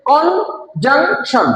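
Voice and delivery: three spaced beats on কনজাংশন; broken into syllables. Shown as a demonstration. Teacher shows three syllables to class, কনজাংশন broken apart. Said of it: "তিনবারে".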